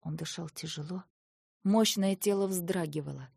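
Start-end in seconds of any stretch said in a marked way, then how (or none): none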